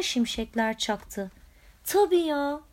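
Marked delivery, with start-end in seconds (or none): 1.8-2.6 surprised. A narrator's reading. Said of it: "dedi hayretle".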